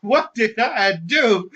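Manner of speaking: high, slow, exaggerated fake innocence; mock innocent heel. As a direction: play the wronged villain, hammed up. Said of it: "Then, then I started going".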